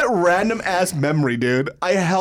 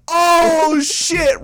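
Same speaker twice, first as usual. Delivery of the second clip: high, loud drawn-out "oh shit"; crowd reaction; 11 semitones higher, 5 dB louder than usual. Retold or imitated. Everybody reacting to a joke, loud mock shock. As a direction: hoot it like an egging-on crowd.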